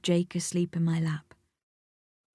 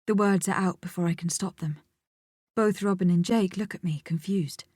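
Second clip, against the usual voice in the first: ordinary delivery, nothing marked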